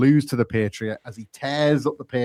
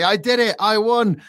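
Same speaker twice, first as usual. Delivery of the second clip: breathless celebration; 10 semitones higher, slower than usual. Retold or imitated. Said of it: "as he's celebrating breathlessly".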